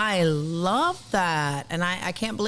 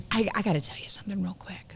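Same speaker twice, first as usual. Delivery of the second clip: hushed secret whisper; 3 semitones higher, 6 dB quieter, faster than usual. Real, not acted. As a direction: lean in and whisper it. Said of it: "why are you whispering?".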